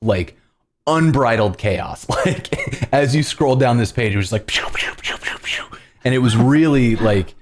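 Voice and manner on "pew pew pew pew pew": rapid whooshing pew sounds; quick mouth-made sound effects; imitated pew noises. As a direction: fire off a run of little pews. Imitating scrolling page chaos.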